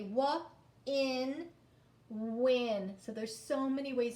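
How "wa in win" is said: "wa", "in", then "win", stretched; sounding out. Shown as a demonstration. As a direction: blend the sounds slowly. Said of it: "we can also say".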